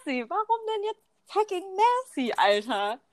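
high-pitched mock incredulity at "Merci"; mock bafflement. Retelling her reaction to Swiss people saying merci, mock bafflement. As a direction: question it in exaggerated bewilderment, starting high. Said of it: "Und du bist so".

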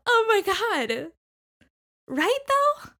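high, loud burst of agreement; excited agreement. Real, not acted. Co-host agreeing the film is creepy, excited agreement. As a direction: exclaim it high and loud, scandalized.

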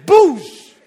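loud imitated splat; mock hit noise; impact sound. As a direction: smack the sound out. Coffee hits chest.

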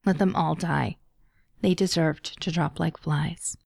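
ordinary delivery, nothing marked

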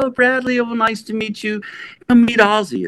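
put-on high voice of Sharon greeting; high Sharon impression. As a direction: gush like an eager hostess.

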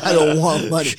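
loud, laughing repeat; loud mock quote. Repeating the farmer's line loudly to the host. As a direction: belt the line out again. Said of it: "He said".

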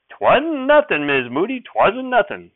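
high, loud folksy aw-shucks; bashful sheriff. Acted. Sheriff waves off Mother's thanks in a bashful folksy voice. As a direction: brush off the thanks like a shy country lawman.

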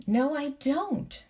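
high, drawn-out sulky denial; almost sulky. A narrator's reading. Curdie denying what the princess sees, almost sulkily. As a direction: drag it out, a touch sulky. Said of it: "almost sulkily".

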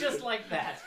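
said through laughter, pitched high; laughing. Real, not acted. Host agreeing his favorite changed just like that, laughing. Still laughing after the joke.

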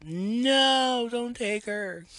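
put-on high, loud cry of a mother losing her child; desperate yell. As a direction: shout it as she's taken away. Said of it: "Liberty yells out".

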